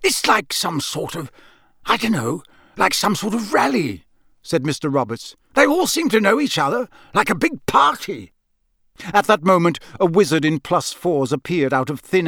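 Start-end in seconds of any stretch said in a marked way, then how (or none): 0.0-4.1 lightly voiced local, groping for words; Mister Roberts. Campsite keeper guessing about the crowd, lightly voiced. 5.5-8.3 high-pitched, wondering local; Mister Roberts. Acted. Campsite keeper wondering at the guests, high-pitched.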